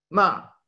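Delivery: short, high MA dropping sharply; falling tone. Shown as a demonstration. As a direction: start MA high and drop it quickly. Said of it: "el cuarto tono es bajando".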